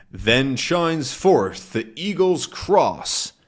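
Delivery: slow, grand recitation; ceremonious riddle reading; solemn riddle. Acted. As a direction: intone it like an ancient clue. Quoting the riddle.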